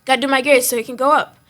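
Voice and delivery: normal talking voice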